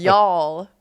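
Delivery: put-on Y'all said with a French accent; a French speaker trying Y'all; Y'all with a French lilt. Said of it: "doesn't sound right with a French accent".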